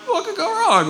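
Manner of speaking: high, lilting mock innocence; mock naive. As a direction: toss it off in a high put-on voice. Mock-naive quote before being robbed.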